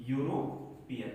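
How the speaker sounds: यूरोपियन drawn out slowly with a yoo glide at the start; teacher sounding out the word slowly; slow pronunciation demo. Shown as a demonstration. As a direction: stretch out यूरोपियन, lean on the opening yoo. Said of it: "क्या निकाला आपने?".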